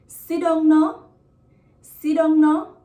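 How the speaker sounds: "Sit down no" loud, pitched high, rising on "no"; Patois request with "no" going up high; high rising intonation. Shown as a demonstration. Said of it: "the intonation is kinda high".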